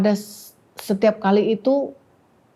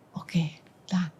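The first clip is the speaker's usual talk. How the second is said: hushed, near-whispered reply; retold answer of God; 2 semitones lower, 9 dB quieter, slower than usual. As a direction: speak God's answer very softly. (jawaban Tuhan setengah berbisik)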